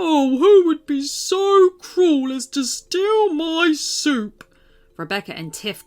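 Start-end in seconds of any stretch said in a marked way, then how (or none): 0.0-4.4 slow, louder, higher troll lament; sobbing troll. Acted. The robbed troll sitting down and sobbing, slow loud troll lament. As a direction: wail it out like a heartbroken troll. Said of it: "fell onto his behind and sobbed".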